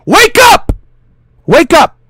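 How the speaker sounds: loud urgent shout, rising on the second wake up. Real, not acted. Streamer yelling at his viewers to wake up, loud shout. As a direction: yell at them to wake up.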